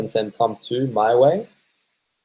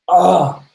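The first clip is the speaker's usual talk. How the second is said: sudden yelp; 9 semitones higher, 6 dB louder than usual. Real, not acted. Sudden loud exclamation.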